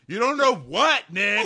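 loud, very high challenge; imitated boyfriend exploding; mock furious yell. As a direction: yell it in hammed-up outrage. Voicing Josh confronting Nick.

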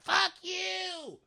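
hammed-up furious screech; imitated screeching. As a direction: squeal the curse way up high. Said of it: "she's like she is right now".

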